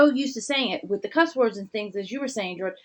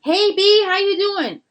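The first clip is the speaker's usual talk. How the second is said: high, loud, over-friendly hello; imitating a friend's loud hey; mock hyped greeting; 12 semitones higher, 8 dB louder, slower than usual. Friend greeting her on the street, hammed-up loud and cheery.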